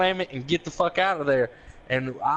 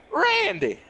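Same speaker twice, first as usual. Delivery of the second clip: much higher, surprised cry of the name; startled call; 12 semitones higher than usual. Retold or imitated. Retelling Wayne recognising Randy, a high startled call. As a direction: caught off guard, calling out high. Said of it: "he went".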